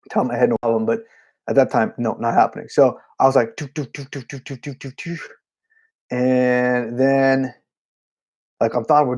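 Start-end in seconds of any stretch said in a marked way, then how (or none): 3.6-5.2 quiet doo doo hum; soft hummed beat. Retold or imitated. Nervous drummer humming along to the song. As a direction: hum the groove under your breath.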